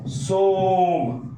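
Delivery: सोम stretched very long on the ओ; prolonged vowel. Shown as a demonstration. Teacher showing students the long सोम. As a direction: hold सोम as a three-beat vowel. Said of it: "इस हो गया सो".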